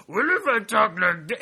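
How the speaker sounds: high, silly crazy-monkey voice; Adam Sandler monkey voice. Retold or imitated. Mocking the actor's studio pitch in a silly high monkey voice. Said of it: "Adam Sandler ... was like".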